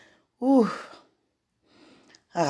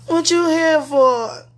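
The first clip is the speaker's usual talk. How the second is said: high, wavering disbelief; 11 semitones higher, 4 dB louder, slower than usual. Real, not acted. Overwhelmed, high pitched and slow.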